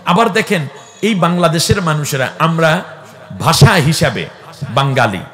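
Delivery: loud, high call-and-response preaching; full-force preaching. Acted. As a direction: rouse the crowd and make them answer back. (উচ্চস্বরে জোরালো ওয়াজ)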